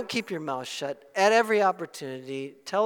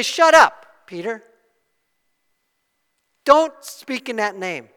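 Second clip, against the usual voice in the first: mock angry order; 4 semitones higher, 11 dB louder than usual. Leaders silencing Peter.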